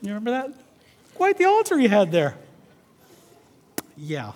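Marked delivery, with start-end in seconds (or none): 1.2-2.4 imitating the wife's blunt remark, pitched up; lightly voiced blunt retort. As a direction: repeat the pointed rebuke as the wife. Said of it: "be as blunt as she needed to be".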